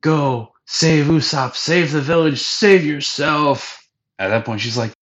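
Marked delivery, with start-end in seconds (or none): 0.0-3.9 high, drawn-out melodramatic plea; lightly hammed-up farewell; dramatic dying plea. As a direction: ham up a dying hero's last words.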